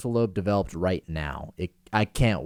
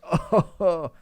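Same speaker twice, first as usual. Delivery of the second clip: guilty laugh; 9 semitones higher than usual. Retold or imitated.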